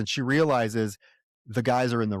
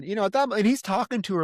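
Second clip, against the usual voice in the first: normal talking voice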